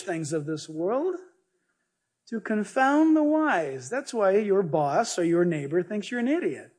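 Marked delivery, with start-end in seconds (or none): none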